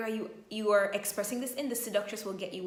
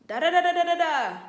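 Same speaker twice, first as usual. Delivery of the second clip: loud, high mock yelling babble; mock loud rant; imitating a shouting partner; 8 semitones higher, 9 dB louder than usual. Shown as a demonstration. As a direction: play the one raising his voice. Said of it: "Imagine the voice is here".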